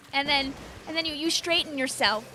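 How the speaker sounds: normal talking voice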